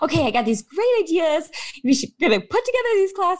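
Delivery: high-pitched eager planner, hammed up; imitated enthusiasm. Friend imitating a patient pitching class ideas, enthusiastic. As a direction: bubble over with ideas, mimicking him. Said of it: "just so enthusiastic".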